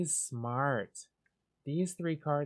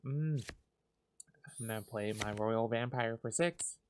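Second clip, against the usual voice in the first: ordinary talk, nothing marked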